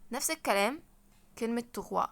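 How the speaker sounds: usual speaking voice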